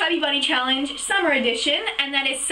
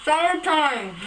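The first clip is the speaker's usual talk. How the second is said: talking through marshmallows; mouth full; slower than usual. Real, not acted. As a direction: say it with cheeks stuffed full. Chubby bunny, mouth full of marshmallows.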